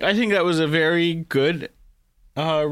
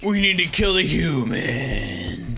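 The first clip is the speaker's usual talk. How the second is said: slow, drawn-out villain voice; hammed-up menace, last word dragged long; mock alien villain; slower than usual. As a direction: stretch it out like a cartoon evil alien.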